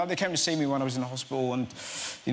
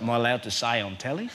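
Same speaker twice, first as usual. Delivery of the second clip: lower-pitched Australian bloke; Australian football fan; 4 semitones lower than usual. Retold or imitated. Football fan to TV interviewer, Australian accent. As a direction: put on a low Aussie accent. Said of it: "interview an Australian rules football fan".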